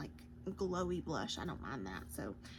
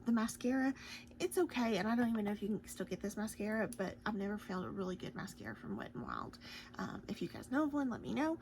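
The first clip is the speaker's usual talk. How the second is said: ordinary talk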